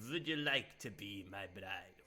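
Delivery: slow, badly faked French-tinged vampire accent. Streamer reading Vlad the Vampire's proposal, bad French-sounding accent. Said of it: "I'm afraid I'm doing a French accent".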